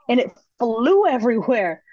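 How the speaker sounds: ordinary talk, nothing marked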